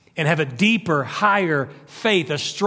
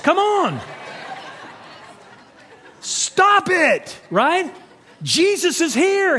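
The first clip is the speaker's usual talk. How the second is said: preaching at full force; 8 semitones higher than usual. Real, not acted. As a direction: press the congregation hard.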